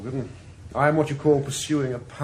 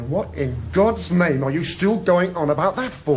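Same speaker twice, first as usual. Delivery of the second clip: louder, fed-up demand; exasperated outburst; 5 semitones higher, 7 dB louder than usual. Acted. Inspector rounding on Morgan over Allenby, raised angry voice. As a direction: snap at him like a fed-up superior. Heated argument between detectives.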